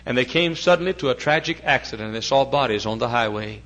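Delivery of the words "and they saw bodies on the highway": usual speaking voice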